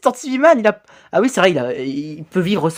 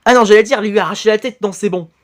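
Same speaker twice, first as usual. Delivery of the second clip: ordinary delivery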